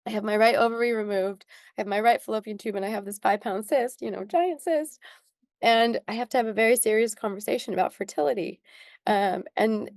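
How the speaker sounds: usual speaking voice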